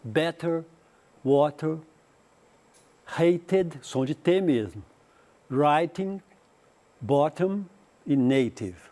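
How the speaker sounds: T said crisply as a real T in Better, water, hated; British clear T. Shown as a demonstration. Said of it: "você fala o T".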